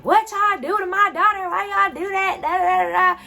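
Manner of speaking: high put-on voice of an upset parent, demanding answers; angry parent. Parent storming in over her daughter, shrill indignant put-on voice.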